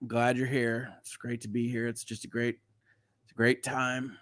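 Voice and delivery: low, soft, gloomy deadpan; depressed deadpan. Pretend. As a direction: mumble it like nothing's worth it. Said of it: "Does anything not suck for you?".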